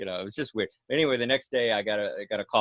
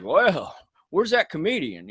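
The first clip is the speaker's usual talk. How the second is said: [name] voice; 5 semitones higher, slower than usual. Retold or imitated. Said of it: "in [name] voice".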